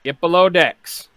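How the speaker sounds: loud bosun assigning a job; bosun giving orders. Acted. Bosun sending Tuttle below decks as rat catcher, loud order. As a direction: bark the job at the new hand. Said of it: "And he says".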